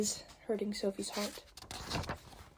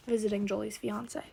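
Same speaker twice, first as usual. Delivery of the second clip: usual speaking voice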